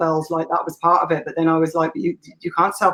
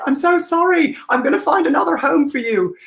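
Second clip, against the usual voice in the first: tearful apology; 9 semitones higher than usual. Retold or imitated. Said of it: "being like".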